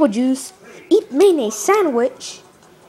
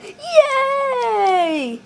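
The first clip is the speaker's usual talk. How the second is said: high, long drawn-out squeal; gleeful cheer; 11 semitones higher than usual. Acted. Luigi cheering the promised console, gleeful. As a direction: stretch one word into a high cheer.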